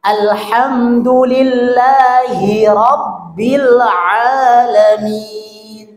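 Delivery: very slow "Alhamdulillahi rabbil alamin" with clear "hi"; correct recitation. Shown as a demonstration. Teacher modelling the verse for the class. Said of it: "harus dibaca jelas".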